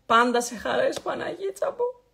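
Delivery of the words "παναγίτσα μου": high exclamation. Acted.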